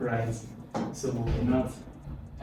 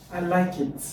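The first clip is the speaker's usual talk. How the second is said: LIKE IT held high, hesitant agreement; fall-rise demo; 5 semitones higher than usual. Shown as a demonstration. Teacher showing partial agreement, fall-rise intonation. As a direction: lift LIKE IT, not quite committed. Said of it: "In fall rise intonation".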